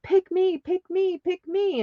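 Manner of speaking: high repeated pick me; eager begging. Retold or imitated. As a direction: beg like kids waving their hands. Everybody begging to be chosen.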